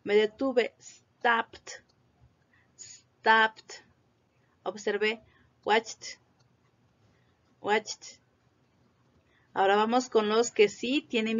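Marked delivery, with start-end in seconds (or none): 0.8-3.9 "stopped" as one syllable, "stopt"; audible final T. Shown as a demonstration. Teacher models for repetition, audible final T. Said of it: "Se tiene que oír la T". 5.6-8.2 "watched" as one syllable, "watcht"; audible final T. Shown as a demonstration. Teacher models for repetition, audible final T. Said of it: "Se tiene que oír la T".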